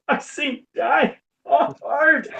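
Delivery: slow, drawn-out mock blubber; mock weepy Tyler. Friend teasing Tyler about crying at Die Hard, slow mock sob. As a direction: whimper it out slowly like a teary moviegoer.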